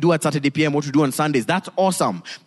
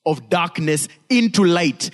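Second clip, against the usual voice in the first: ordinary delivery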